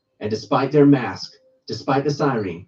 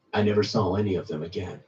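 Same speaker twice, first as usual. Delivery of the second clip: usual speaking voice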